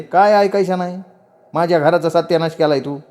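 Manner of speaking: high-pitched, accusing aunt; scolding chachi. Acted. Aunt refuses to hear him out, accusing.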